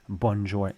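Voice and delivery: "bonjour" with an audible English N; anglicised "bonjour", N fully pronounced; English speakers' pronunciation. Retold or imitated. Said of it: "I've heard some English speakers say".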